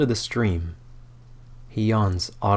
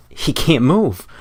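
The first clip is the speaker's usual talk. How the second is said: frozen panic; 6 semitones higher, 6 dB louder, slower than usual. Acted. Lear frozen in distress.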